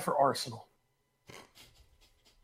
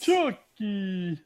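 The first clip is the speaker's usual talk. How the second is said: put-on Spanish accent with a playful flourish; fake Spanish accent. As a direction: toss the name out in a hammy Spanish accent. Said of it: "it's a fake Spanish accent".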